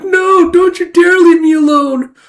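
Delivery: high, loud, slow mock wail; anguished wail. Sister pleading with her dying brother, anguished wail. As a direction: melodramatic plea at a deathbed.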